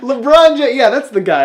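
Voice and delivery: high, loud teasing through laughter; laughing mockery. Real, not acted. Podcast host teasing co-host over the basketball player's name, laughing loudly. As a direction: crow it while cracking up.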